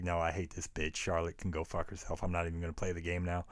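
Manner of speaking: muttered inner grumble. Retold or imitated. Inner reaction to an annoying character.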